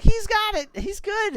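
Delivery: put-on loud, high kid voice; high-pitched, insistent mock report; child's urgent reply. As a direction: imitate a little kid shouting back.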